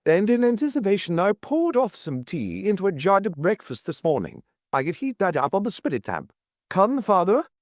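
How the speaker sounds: high, smug triumphant reveal; spring it like a trap. A narrator's reading. Mrs Caldecott outwitting the guide, smug triumph. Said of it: "the air of one springing a mine".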